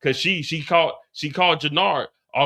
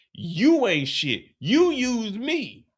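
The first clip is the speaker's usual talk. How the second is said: very slow, each word laid down; slow retort; 4 semitones higher, much slower than usual. Retold or imitated. Jannard slowly fires back at Shanti. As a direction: drag it out word by word. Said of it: "But then Jannard say".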